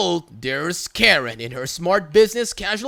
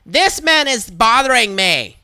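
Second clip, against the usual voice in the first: higher, louder accusing whine; accusing Karen; 5 semitones higher, 6 dB louder than usual. Retold or imitated. Karen complaining about the shopper to the worker, loud whine.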